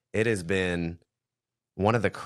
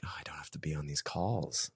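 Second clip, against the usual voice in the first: whisper; 2 semitones lower, 9 dB quieter than usual. Retold or imitated. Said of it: "and I'm like".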